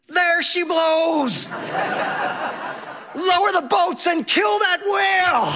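put-on thrilled kid bellowing at the whale, slow and high; excited scream. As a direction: shout it like a little Ahab on deck. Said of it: "And Henry screams".

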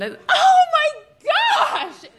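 high, drawn-out shocked shriek; scream. Retold or imitated. Girl realizing the dirty joke in class, shocked high shriek. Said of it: "I screamed".

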